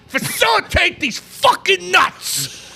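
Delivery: high, slow, shouted retort; hot with anger. Real, not acted. Fan scoffs at the facilitator excuse, hot with anger. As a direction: throw the word back with scorn. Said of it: "I'm so fucking hot right now".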